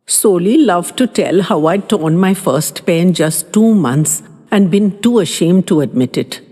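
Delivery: usual speaking voice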